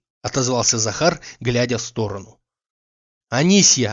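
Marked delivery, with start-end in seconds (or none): 3.3-3.9 louder call across the house. Acted. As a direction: shout for Anisya. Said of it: "закричал Обломов".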